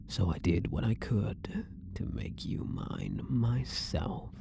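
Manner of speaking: soft, possessive whisper. Acted. Obsessed prisoner whispering his devotion to his guard. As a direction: confess it in a hushed, hungry breath.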